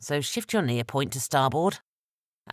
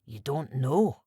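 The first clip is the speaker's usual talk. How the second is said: insulted astonishment; slower than usual. A narrator's reading. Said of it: "whispered back in insulted astonishment".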